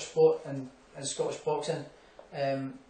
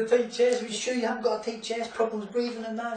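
mock worried official; 6 semitones higher than usual. Agent asking about symptoms.

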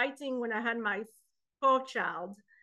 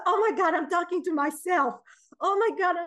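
mock alarm; 5 semitones higher, 5 dB louder than usual. Retold panic at talking to herself.

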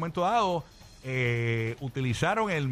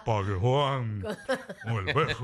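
laughing put-on artist voice, lightly done; [name] voice; 4 semitones lower, slower than usual. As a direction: mimic the singer's voice while laughing. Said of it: "¿Cómo es la voz de [name]?".

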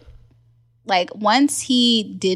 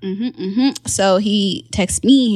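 ordinary delivery

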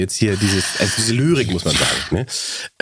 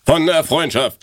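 slow, louder announcing of the title; recital title; 4 semitones higher, 3 dB louder, slower than usual. Shown as a demonstration. Reading the title aloud to her friend, slow and theatrical. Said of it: "in meiner perfekten Betonung lesen".

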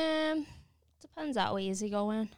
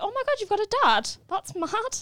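amazed; 8 semitones higher, 8 dB louder than usual. Retold or imitated. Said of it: "I was like".

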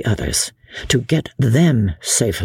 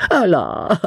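a light, grinning "Oh, la"; airy foppish exclamation; good-humoured; 4 semitones higher than usual. A narrator's reading. Sir Percy greeting Chauvelin with a grin, lightly amused. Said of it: "with a good-humoured grin".

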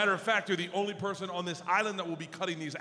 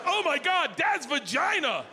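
high, loud, slow retold scream; horrified shriek; 10 semitones higher, 5 dB louder, slower than usual. Daughters shriek walking in.

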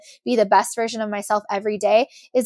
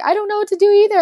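mom's voice pitched up high and louder, at a loss; helpless emotional mom; 11 semitones higher, 6 dB louder, much faster than usual. Retold or imitated. Daughter voicing her helpless mom, high and louder. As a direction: play the overwhelmed mother who has no answers. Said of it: "my mom is more of the emotional one that's like".

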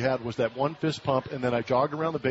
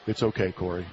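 lower, gentle, comforting reply; reassuring friends; 6 semitones lower than usual. Retold or imitated. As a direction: ease her awkwardness kindly. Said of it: "the girls were like".

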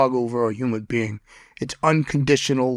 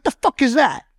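high, disbelieving mock outburst; much higher startled retort; incredulous; 12 semitones higher, 3 dB louder than usual. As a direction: fire it off as if you can't believe it. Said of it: "I'm like".

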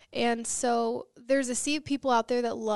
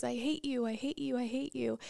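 softer, fading echo of the yell; a quieter, faraway answer; distant echo; 5 dB quieter than usual. Retold or imitated. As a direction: let it come back small from far off. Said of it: "that echo comes back".

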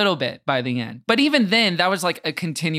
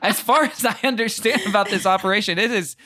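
mock official; 5 semitones higher than usual. Host jokes the podcast runs itself, mock official. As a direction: explain it like a deadpan bureaucrat.

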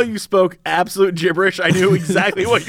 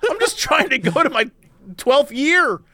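higher, whiny and exasperated; whiny complaining voice; 7 semitones higher than usual. Pretend. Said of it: "I'd be like".

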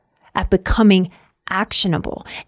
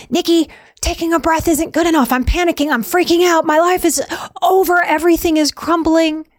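high, frantic, pitched way up; panicked inner voice; 7 semitones higher than usual. Acted. The anxious part of us protesting to Nikki, high and panicked. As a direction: play the panicking part that insists it's too late. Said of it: "There's a part of us that will say".

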